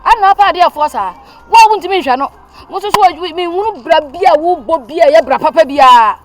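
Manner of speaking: loud, sharp scolding of a daughter. Acted. Mother angrily scolding her daughter, loud. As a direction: tell her off at full volume.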